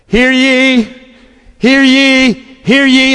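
loud, ringing herald's call; town crier. Retold or imitated. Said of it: "it would sound like this".

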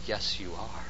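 low, soft insistence; hushed, understated correction; firm answer. Acted. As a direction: overrule it quietly, voice dropped. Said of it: "And you say".

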